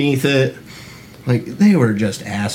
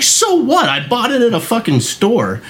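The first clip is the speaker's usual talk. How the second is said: higher, sharp defiant comeback; defiant retort; 9 semitones higher than usual. Retold or imitated. Retold comeback to the boss, higher and defiant. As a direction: throw it back at her. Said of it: "I was like".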